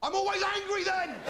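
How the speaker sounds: sudden high furious yell on "always"; angry shout. Retold or imitated. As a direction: explode into a shouted retort. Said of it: "they have to say".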